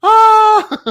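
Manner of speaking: sudden high loud yelp; startled shriek. Real, not acted. Streamer fumbling the bottle, sudden high yelp.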